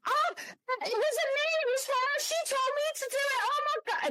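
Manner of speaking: high squealing mock panic; panicked denial. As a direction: blame the friend in a high-pitched fluster. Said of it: "And she's going".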